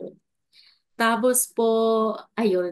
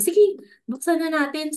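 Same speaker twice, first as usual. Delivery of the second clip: mother's voice; 7 semitones higher than usual. Retold or imitated. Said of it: "sabi yung ganun".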